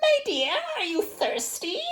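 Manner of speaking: put-on very high, frail old crone; very old woman. A narrator's reading. Old woman with a bundle asks the weaver, very high and frail. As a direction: croon it like an ancient stranger.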